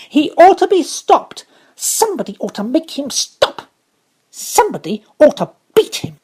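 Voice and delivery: put-on high, loud furious girl's cry; shrill child's tantrum; angry outburst. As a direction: stamp and rage at the noise. Mary stamps in a rage.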